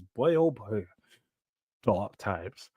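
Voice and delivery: gloomy. Real, not acted. Gloomy aside on conceding.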